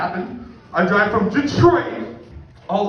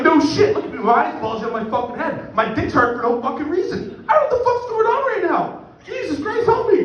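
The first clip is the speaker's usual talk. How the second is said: high-pitched frantic ranting, climbing higher; mock panic; 10 semitones higher than usual. Patient with high blood pressure to medics, mock panicked rant. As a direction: rant in escalating hammed-up panic.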